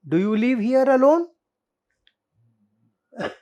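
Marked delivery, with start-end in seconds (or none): none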